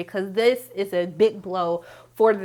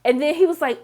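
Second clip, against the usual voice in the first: ordinary delivery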